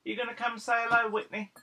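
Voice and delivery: high, quick coaxing call; coaxing pet talk. Real, not acted. Owner inviting dog to say hello, coaxing high.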